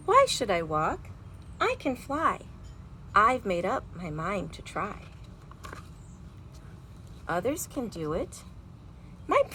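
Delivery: lively storybook read-aloud with big feelings; reading to children. A narrator's reading. Teacher reading a picture book aloud to her class, animated. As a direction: read it like a bedtime story for kids.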